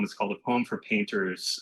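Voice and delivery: ordinary delivery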